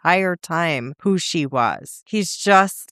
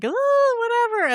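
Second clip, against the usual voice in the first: dismissive. Retold or imitated. Kane brushing off his captors.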